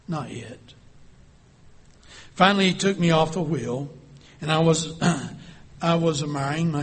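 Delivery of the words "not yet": soft, low, smiling reply; gentle. Acted. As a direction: reassure without giving in. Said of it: "smiled and said".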